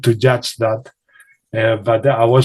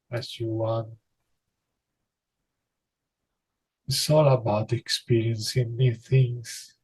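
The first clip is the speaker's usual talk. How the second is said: soft channeling voice; 6 dB quieter than usual. Acted. Guide speaking to the listener about their powers, soft and hushed. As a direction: murmur it like a gentle spirit guide.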